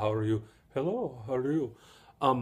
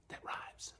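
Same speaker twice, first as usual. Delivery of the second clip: whispered aside; 18 dB quieter than usual. Real, not acted.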